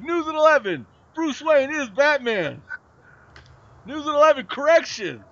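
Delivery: high, loud hammed-up newscaster; mock evening-news headline read; TV news anchor. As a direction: announce it like breaking news on TV.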